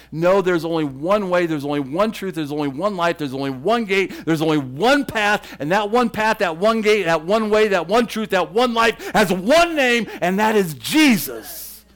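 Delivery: loud, rising fervent preaching; worked up. Real, not acted. Preacher to parents, real worked-up fervour. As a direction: pound each line harder and harder. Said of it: "I'm getting a little worked up".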